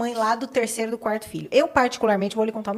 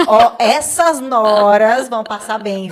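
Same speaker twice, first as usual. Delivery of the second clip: laughing; 11 dB louder, much slower than usual. Real, not acted. Teasing about handsome sons.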